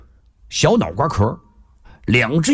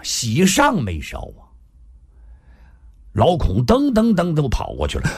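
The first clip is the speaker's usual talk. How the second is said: ordinary delivery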